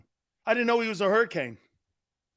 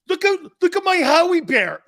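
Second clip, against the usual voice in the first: much higher and louder, showing off a toy; mock proud kid; 11 semitones higher, 8 dB louder than usual. A fan showing off his Howie bear, high excited kid voice. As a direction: squeal it like a kid with a new toy. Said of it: "you're sitting there going".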